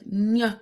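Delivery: "ny" as a bare "nyuh", held on the nasal; ny sound. Shown as a demonstration. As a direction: demonstrate the ñ alone as "ny". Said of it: "you have to make a".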